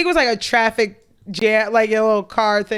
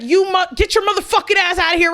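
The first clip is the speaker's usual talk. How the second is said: mock yell; 8 semitones higher than usual. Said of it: "right out of nowhere".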